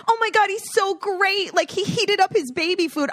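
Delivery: high, mock-gushing praise; gushing friend. Parodying friends praising a grandpa, high gushing. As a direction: coo over a trivial task. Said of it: "It's like".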